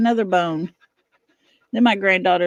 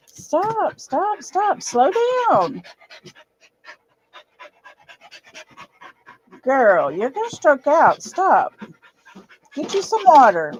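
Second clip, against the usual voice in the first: much higher, rising urgency telling the dog to stop; urgent pleading; 6 semitones higher than usual. Real, not acted. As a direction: beg the dog to calm down, pitched way up.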